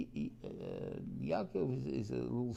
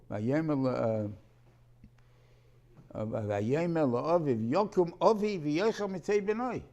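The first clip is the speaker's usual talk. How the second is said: son calling his father loudly, higher; 4 semitones higher, 9 dB louder than usual. Acted. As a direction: call out loud to wake him. Son calls father to rise.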